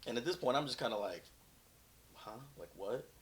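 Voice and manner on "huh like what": softly baffled question; puzzled, understated reaction; mild shock. Retold or imitated. Off-duty cop baffled by officer's orders, mildly shocked. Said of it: "I'm kinda in shock".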